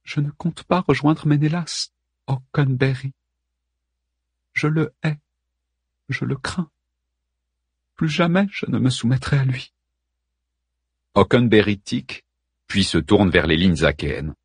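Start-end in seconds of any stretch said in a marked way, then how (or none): 0.0-9.7 Helen's voice. Acted. Helen speaks of Menelaus.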